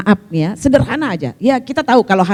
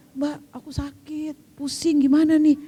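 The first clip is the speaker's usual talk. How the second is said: faint, drawn-out complaint; imitated sick friend; 7 dB quieter than usual. Friend on a packed train complaining she is dizzy, acted feebly. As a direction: act weak and unwell, much softer.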